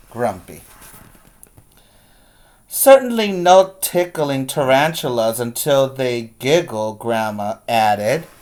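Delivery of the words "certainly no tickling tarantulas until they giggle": put-on lively storybook reading for kids; read-aloud story voice. A narrator's reading. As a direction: read it like a teacher to the class.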